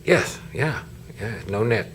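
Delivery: usual speaking voice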